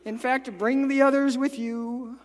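high, loud, coaxing ghost voice; sneaky ghost. Retold or imitated. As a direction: lure Peter into the water. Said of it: "I'd be like".

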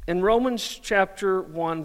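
ordinary delivery

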